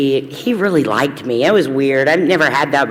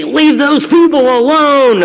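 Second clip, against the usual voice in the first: stern alumni ladies; 8 semitones higher, 4 dB louder, slower than usual. Retold or imitated. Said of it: "they would say".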